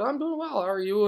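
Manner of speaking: high-pitched mock girl's reply; imitating a girl. Girl answering a stranger at the crosswalk, imitated high-pitched.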